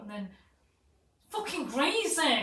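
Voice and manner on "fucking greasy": high, loud, stretched-out mock yell; acted outrage. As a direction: wail it in imitated disgust. Retold outburst over greasy hair.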